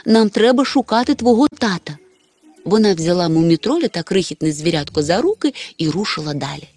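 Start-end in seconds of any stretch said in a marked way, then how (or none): 0.1-2.0 put-on mother mildly urging her child, raised pitch; hurrying along. A narrator's reading. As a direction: gently chivvy the child onward. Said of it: "поквапила синочка мама". (мама злегка підганяє синочка)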